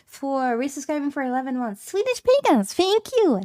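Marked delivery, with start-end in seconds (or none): 1.9-3.4 high excited call of a name; excited thanks. Real, not acted. Streamer thanking a subscriber, excited and high.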